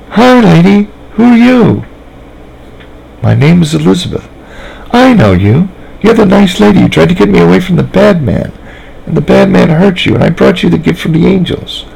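put-on high, sweet small-girl voice; Sally chatting innocently to the nice lady; little girl voice. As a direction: talk like a trusting little girl.

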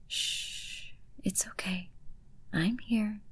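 very soft unvoiced hush; calm him down with a near-silent shh; soothing hush. Easing her good boy down, soft soothing whisper.

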